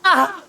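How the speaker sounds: sharp high cry of pain; short loud pained shout; pained yell. Acted. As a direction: yelp like you've been hurt. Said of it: "hear Vils yell out in pain".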